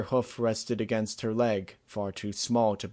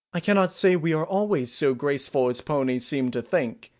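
higher, louder character voice; a princess explaining herself, pitched up; lightly voiced princess; 5 semitones higher, 5 dB louder than usual. A narrator's reading. Voices Celestia in narration.

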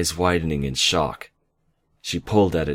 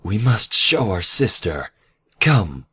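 higher, excited regal urging; eager summons; 4 semitones higher, 5 dB louder than usual. A narrator's reading. Nightmare eager to show her sister, excited. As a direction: announce it eagerly, then a loud command.

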